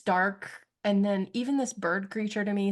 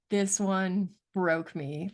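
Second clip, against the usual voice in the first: ordinary talk, nothing marked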